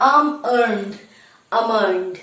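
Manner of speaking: almond as aa-mund, L silent; correct pronunciation. Shown as a demonstration. Teacher showing how we pronounce it, correct pronunciation. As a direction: say almond in two beats without the L. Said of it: "এল উচ্চারণ করবো না".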